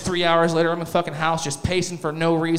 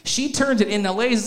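ordinary delivery

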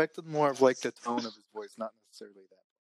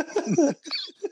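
high laugh. Real, not acted. Laughing at his photoshop joke.

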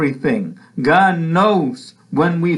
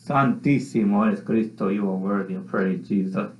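murmured praise; 8 semitones lower, 6 dB quieter than usual. Real, not acted. Worship murmur mid-sermon.

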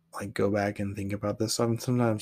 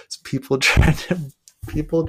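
louder, higher, breaking into a laugh; real amused laugh mid-sentence; 6 semitones higher, 7 dB louder than usual. Real, not acted. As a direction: laugh through the words. Amused at letter's outcome.

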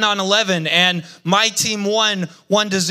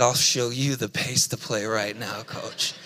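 muttered much softer and lower, coach barely whispered; a teen's defiant comeback kept under his breath; quiet defiant mutter to the coach; retold under-breath defiance; 9 semitones lower, 8 dB quieter than usual. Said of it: "I said".

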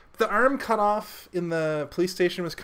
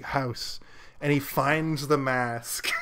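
usual speaking voice

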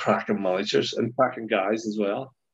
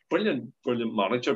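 normal talking voice

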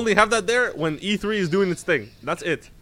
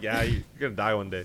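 mock grim reply; 8 semitones lower, 8 dB quieter than usual. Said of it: "And then you're just like".

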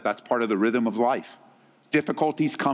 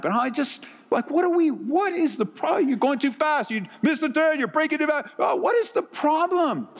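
high, exasperated nagging in the car; anxious nagging backseat driver; 13 semitones higher than usual. Retold or imitated. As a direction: squeal complaints at the driver. Couple fighting on way to church.

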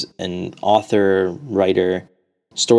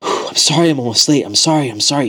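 mildly breathless apologies, a touch higher; lightly voiced out-of-breath young man; panting; 6 semitones higher, faster than usual. A narrator's reading. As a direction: gasp out the sorrys after running. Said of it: "he's panting".

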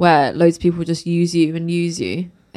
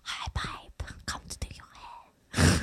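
put-on Essex accent; 14 dB quieter than usual.

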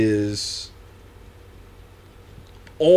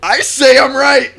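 mock shout; 12 semitones higher, 10 dB louder than usual. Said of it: "mock triumphant outburst".

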